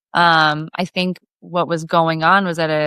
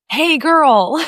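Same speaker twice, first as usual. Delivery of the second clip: put-on higher, a bit louder, rising hey girl; mock catcall; 9 semitones higher, 4 dB louder than usual. A fisherman calling to her across the cove, mock catcall. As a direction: call out across the water.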